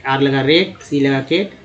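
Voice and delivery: ordinary talk, nothing marked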